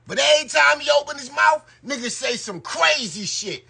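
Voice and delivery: ordinary talk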